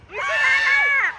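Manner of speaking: high-pitched shout to a player; calling out. Real, not acted. Parent telling their child to go easy, calling out.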